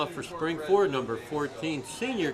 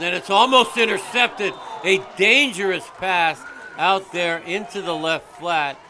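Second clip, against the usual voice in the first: excited; 4 semitones higher, 9 dB louder than usual. Real, not acted. Near interception play-by-play.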